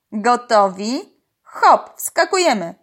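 rally the crew with a drawn-out question, then a lively cry; calling out. A narrator's reading. Uncle sends the snorkelling crew in, calling out. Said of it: "zawołał wuj Urwisa".

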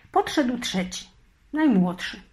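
plain reading voice

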